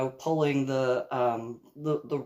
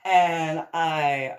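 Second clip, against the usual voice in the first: ordinary delivery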